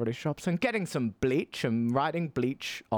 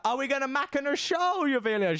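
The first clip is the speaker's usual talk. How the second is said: raised voice; 12 semitones higher than usual. Retold or imitated. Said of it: "And I was like".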